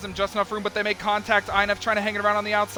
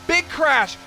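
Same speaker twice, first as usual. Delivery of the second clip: excited call; 11 semitones higher, 7 dB louder than usual. Real, not acted. Crash seen live behind.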